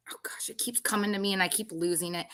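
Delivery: fast, hushed whisper to herself; flustered whisper. Real, not acted. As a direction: rush it under your breath, flustered. Losing her train of thought.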